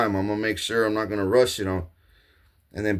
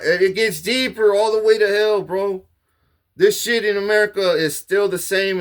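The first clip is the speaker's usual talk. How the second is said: rising rant; 8 semitones higher, 4 dB louder than usual. Real, not acted. Ranting, voice rises.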